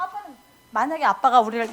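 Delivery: ordinary talk, nothing marked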